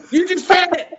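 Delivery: loud, high mock outrage; indignant protest. Son to dad mid-plank, indignant. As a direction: imitate an exasperated kid objecting.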